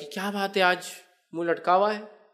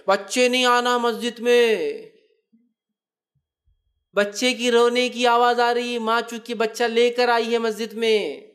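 impassioned preaching; 4 semitones higher than usual. Acted. Preacher urging the congregation, slow and raised. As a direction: press the point on the congregation.

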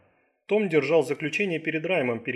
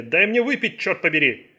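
loud, raised-pitch outburst; exasperated demand; 4 semitones higher, 7 dB louder than usual. Acted. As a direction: burst out, demanding with a curse. Patient demands drink, assistant refuses.

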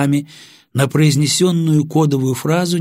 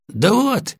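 high, slightly winded reply; slightly breathless; 12 semitones higher than usual. A narrator's reading. Sergeant reporting to officer after kicking prisoner, slightly breathless. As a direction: answer a little out of breath. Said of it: "слегка запыхавшимся голосом".

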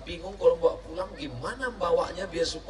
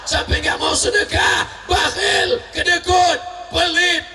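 climbs higher and louder, scolding the stingy father; building preaching shout; 5 semitones higher, 6 dB louder than usual. Real, not acted. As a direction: preach it up to a roar. Preaching at full force rising. (khotbah yang makin keras dan tinggi)

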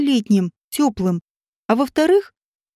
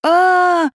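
higher, restrained cry of pain; desperate scream; 5 semitones higher, 4 dB louder than usual. A narrator's reading. Vadim, trodden into the ground, screams desperately. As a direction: cry out in acted agony. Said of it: "отчаянно закричал тот".

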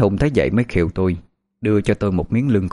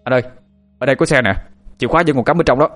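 raised, higher excited call; louder shout of discovery; 5 semitones higher, 3 dB louder, much faster than usual. Acted. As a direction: call the others over to a find. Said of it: "thì anh Thông la lên".

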